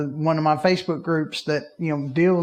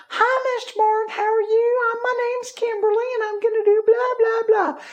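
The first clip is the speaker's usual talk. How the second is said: very high-pitched, put-on chirpy voice; mock an over-perky phone rep; perky caller. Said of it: "she was so just perky".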